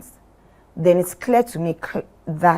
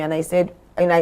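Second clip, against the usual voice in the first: ordinary talk, nothing marked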